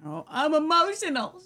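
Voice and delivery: high, whiny mock of a tearful politician; mocking the governor. Mocking the governor's tearful line to the co-host, high and whiny. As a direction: sneer it in a high put-on whimper. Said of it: "she said".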